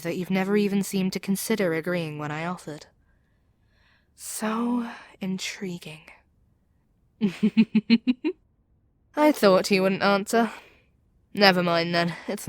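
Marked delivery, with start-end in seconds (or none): 4.1-6.0 slow, drawn-out whisper. Acted. Villain musing over his captive's refusal, slow whisper. 7.2-8.3 a light, amused chuckle. Acted. Villain amused at captive silence.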